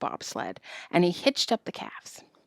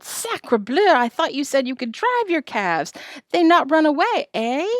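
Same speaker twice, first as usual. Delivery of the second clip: put-on high, scolding child voice; hammed-up outraged boy; indignant French boy; 6 semitones higher than usual. A narrator's reading. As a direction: sputter a reproach, ending in a needling question.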